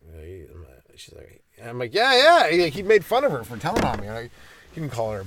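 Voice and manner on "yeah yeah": high, loud eager yeah; eager agreement. Retold or imitated. Boy eagerly agreeing with the teacher, high and loud.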